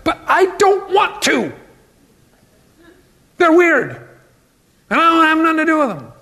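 high, fast sulky refusal; petulant whine. Acted. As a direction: act the grudging believer who won't forgive. Voicing a reluctant, resentful believer.

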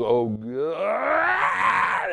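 a retold possessed man's anguished cry, shouted; demonic cry. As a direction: cry out "Oh, God" like a tormented man. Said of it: "and he goes".

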